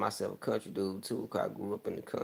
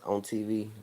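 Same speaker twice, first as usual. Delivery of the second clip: normal talking voice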